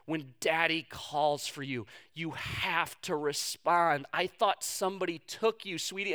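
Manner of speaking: soft, low and slow, understated tearful plea; retold tearful plea. Dad to his found daughter, retold tearful plea. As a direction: gently plead as a shaken dad retold. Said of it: "Why is he sobbing".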